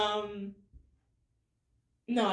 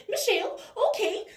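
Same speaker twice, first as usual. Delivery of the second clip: high, whiny Northern Irish voice; high-pitched Northern Irish catchphrase; Northern Irish warm-up; 5 dB louder than usual. Shown as a demonstration. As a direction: slip into a Northern Irish girl's whine. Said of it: "to get into the voice is Michelle".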